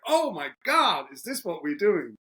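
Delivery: high, slow amazed gasp. Retold or imitated. Tech team amazed.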